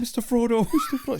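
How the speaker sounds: high, lightly voiced Samwise, laughing; Sam impression. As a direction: squeak out the hobbit's devotion. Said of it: "that was Sam".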